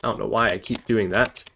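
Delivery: ordinary talk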